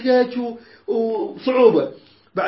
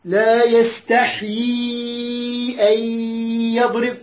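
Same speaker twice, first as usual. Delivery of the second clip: لا يستحي drawn out, أن merged into يضرب; slow recitation; slower than usual. Shown as a demonstration. Teacher reciting to show idgham. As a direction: recite slowly, blending the nun. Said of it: "نون ورا ياء".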